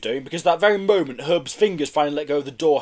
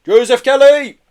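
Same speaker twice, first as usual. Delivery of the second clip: high, very loud official call; booming loudhailer voice; 10 semitones higher, 12 dB louder than usual. A narrator's reading. Police calling to a man in a van, booming. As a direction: boom it out as if through a loudhailer. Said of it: "a voice boomed over a loud hail".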